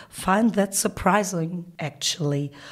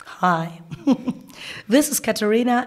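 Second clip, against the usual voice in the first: ordinary delivery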